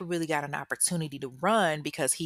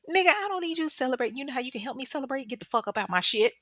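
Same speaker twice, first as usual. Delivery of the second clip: fast, higher, dismissive comeback; exasperated retort; 8 semitones higher, much faster than usual. Pretend. Voicing the girlfriend telling him off, exasperated. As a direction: talk him down quickly and sharply.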